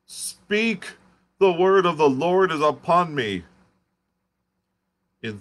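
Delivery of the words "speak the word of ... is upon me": high, loud, possessed proclamation; ecstatic shout. Acted. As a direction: shout it as if seized by the Lord. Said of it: "shouted the curate at the top of his voice".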